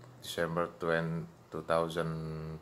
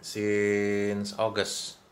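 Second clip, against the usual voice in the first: slow, stretched-out "Since", louder; drawn-out prompt; 3 semitones higher, 6 dB louder, much slower than usual. Shown as a demonstration. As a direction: stretch "Since" to lead the learner. Teacher models answer for learner.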